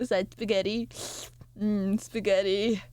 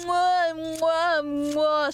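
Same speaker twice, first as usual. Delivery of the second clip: kiss sounds; 6 semitones higher, much slower than usual. Pretend. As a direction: blow big noisy kisses. Blowing kisses to viewer.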